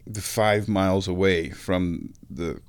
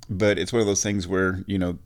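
normal talking voice

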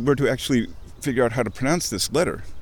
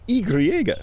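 high Spanish "ee-gree-EH-gah", like a eureka; exclamation of discovery; 7 semitones higher than usual. Shown as a demonstration. Showing their word for Eureka, high exclamation of discovery. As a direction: cry out ee-gree-EH-gah as a find. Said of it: "as an exclamation of discovery".

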